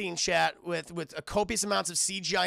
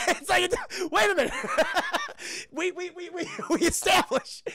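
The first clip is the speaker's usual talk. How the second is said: laughing; 10 semitones higher, 4 dB louder than usual. Real, not acted. Laughing disbelief at plot hole.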